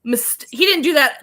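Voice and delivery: ordinary talk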